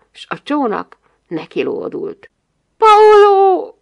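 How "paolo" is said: very high, loud cry of the name; scream. A narrator's reading. Big girl's scream slipping out at Paolo, high shriek. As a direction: let the name burst out as a shriek. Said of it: "szaladt ki a sikoly".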